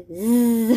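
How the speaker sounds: "ز" held as a long loud buzz; sustained Z. Shown as a demonstration. Teacher demonstrates sustained z sound. («ز» کشیده و وزوزی)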